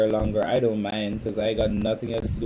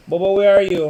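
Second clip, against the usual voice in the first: loud, higher call to a viewer; raised calling voice; 7 semitones higher, 9 dB louder than usual. Real, not acted. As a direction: call out for Bobo.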